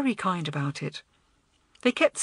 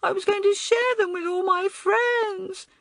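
wailing; 13 semitones higher, 3 dB louder than usual. A narrator's reading. Sobbing small boy protests to older girl, wailing. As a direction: wail like a wronged little boy. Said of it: "he wailed".

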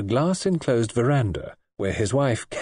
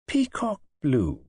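slow, dreamy and drawn out; young wife musing aloud, slow and dreamy; 4 semitones higher, slower than usual. A narrator's reading. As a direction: savour the words as if picturing the room. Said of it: "said Kay dreamily".

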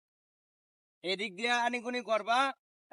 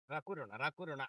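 soothing plea; 8 semitones lower, 9 dB quieter than usual. Acted. Soothing his angry partner.

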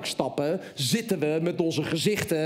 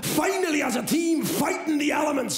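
hammed-up instructor shouting; shouting guide; 4 semitones higher, slower than usual. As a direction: bark orders at the scared father and son. Guide Graham yelling at them.

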